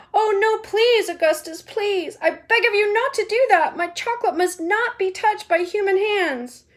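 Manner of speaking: high, loud pleading cry; panicked Wonka pleading high. Acted. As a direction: beg him to stop, high and urgent. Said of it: "he cried out".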